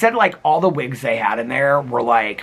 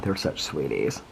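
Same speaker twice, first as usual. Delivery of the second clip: ordinary delivery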